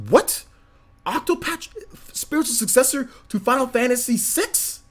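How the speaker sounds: high incredulous questioning, rising at the end; stunned, light-headed. Retold or imitated. Fan hearing news of a Final Fantasy six successor, stunned. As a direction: gasp it out as if about to faint. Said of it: "your boy got a little light".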